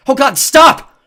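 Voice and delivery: shrill, loud panicked scream; terrified scream. A narrator's reading. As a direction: scream to make it stop. Terrified, covering ears.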